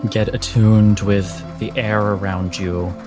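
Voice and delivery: ordinary talk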